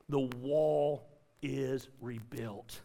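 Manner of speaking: drawn out and low, each word separate; slow proclamation. Real, not acted. Pastor announcing the finished wall, slow and solemn. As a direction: let it land slowly.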